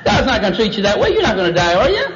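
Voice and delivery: high, wheedling reassurance; put-on tempter's sales pitch; coaxing serpent. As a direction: brush the danger away, lifting the question. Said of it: "He just kind of toyed with it".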